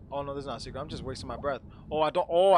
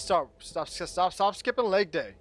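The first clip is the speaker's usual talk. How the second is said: louder and higher, scolding; 3 semitones higher, 7 dB louder, slower than usual. Acted. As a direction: bark the joke at him. Scolding his character's weak jump.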